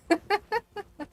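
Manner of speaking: high, loud giggling; laughing. Real, not acted. Streamer laughs at own ruined plans, high giggling.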